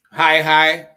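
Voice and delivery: "High, high" loud on one level note; two equally strong, level "high"s; high-high tone pattern. Shown as a demonstration. Teacher explaining two high tone marks, loud level "High, high". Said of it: "when you have two high tone marks".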